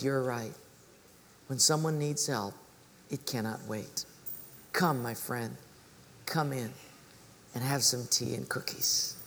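low, soft, tender welcome; gentle relenting. Acted. Cobbler welcoming his troubled friend inside, gentle. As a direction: invite them in quietly, with unhurried pauses.

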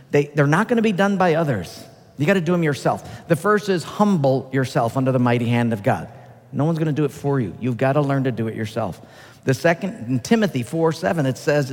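usual speaking voice

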